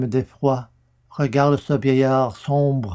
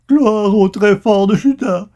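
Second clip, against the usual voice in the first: high, loud frantic plea; desperate praise; 7 semitones higher, 5 dB louder than usual. A narrator's reading. Akab begging God for mercy, a loud desperate cry. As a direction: beg for mercy at full voice. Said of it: "il déchire sa robe".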